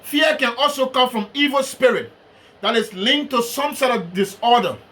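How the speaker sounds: ordinary delivery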